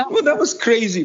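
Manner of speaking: high laughing remark. Real, not acted. Recalling the crazy timing, laughing. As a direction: still laughing as he says it. Laughing comment after story.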